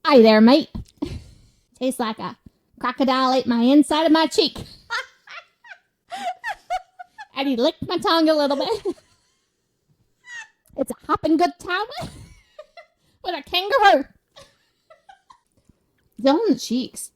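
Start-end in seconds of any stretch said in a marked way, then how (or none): 0.1-0.7 loud, cheery Australian hello; a big Bluey-style Aussie greeting; Australian accent. Pretend. Wine taster doing Australian for a friend, loud and cheery. 1.8-14.0 put-on Australian accent.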